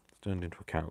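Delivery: usual speaking voice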